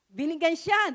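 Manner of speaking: ordinary delivery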